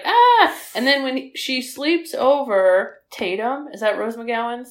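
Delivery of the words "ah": mock yell. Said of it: "he's like".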